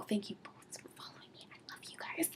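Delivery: soft, fond whisper; hushed affection; whispered thanks. Real, not acted. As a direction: whisper your thanks tenderly.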